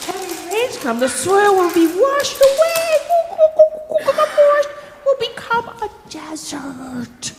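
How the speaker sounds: high, excited monkey voice; high-pitched monkey speech, swooping up and down; monkey character. Acted. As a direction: warn the sleeping man in a high monkey voice.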